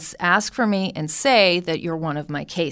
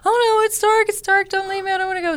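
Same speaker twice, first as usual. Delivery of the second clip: high, loud mock little-kid panic; scared child; 11 semitones higher, 6 dB louder, faster than usual. Young girl begging grandmother not to leave her in the dark, high and whiny. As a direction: play a frightened kid begging. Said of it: "she'd turn off the light".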